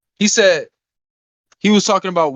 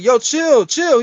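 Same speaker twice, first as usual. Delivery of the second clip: calming him down; 8 semitones higher, 3 dB louder, much slower than usual. Retold or imitated. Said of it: "I'm just like".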